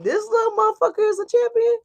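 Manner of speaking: high incredulous mock question; mock disbelief. Viewer doubting the little champion, high mocking disbelief. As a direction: scoff at the so-called champion. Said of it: "And you looking like".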